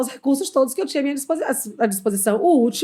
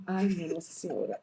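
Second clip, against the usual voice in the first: exasperated aside; 5 semitones lower, 11 dB quieter than usual. Retold or imitated. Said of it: "Eu digo".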